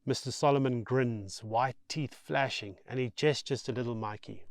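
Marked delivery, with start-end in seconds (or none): none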